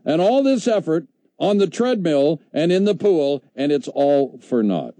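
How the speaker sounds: mock outrage at being told exercise is wasted; indignant gym-goer. Host voicing a listener who resents hearing his workouts were for nothing, slow indignant protest. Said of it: "They're saying".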